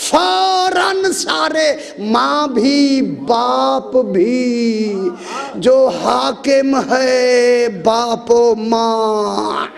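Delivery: drawn out, slow, rising to a cry; slow dramatic telling. Acted. Preacher slowly building the prostration scene, ending in a cry. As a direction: stretch each word out, then let it break.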